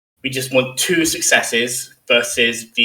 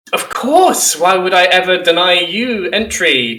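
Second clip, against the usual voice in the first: high-pitched, obliging character voice; welcoming quartermaster; 6 semitones higher, 3 dB louder than usual. Acted. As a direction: play a humble servant welcoming a superior. Game master voices welcoming quartermaster.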